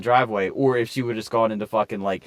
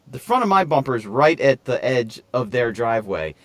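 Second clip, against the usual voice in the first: normal talking voice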